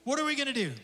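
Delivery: high-pitched mock panic; hammed-up alarm, words tumbling out high; freaking out. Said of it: "They start freaking out".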